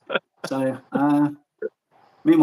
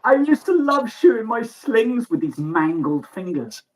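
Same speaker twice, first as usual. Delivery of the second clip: slow, higher creepy voice for a maimed web shooter; web shooter's voice; 6 semitones higher than usual. Retold or imitated. Telling the stream about the web shooter with mangled fingers, in a slow, higher, eerie voice. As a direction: drag it out like a spooky mangled figure. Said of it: "Web shooter standing there going".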